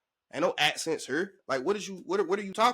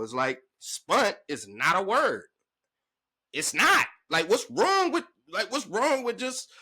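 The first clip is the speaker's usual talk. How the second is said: normal talking voice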